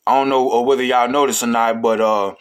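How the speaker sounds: ordinary talk, nothing marked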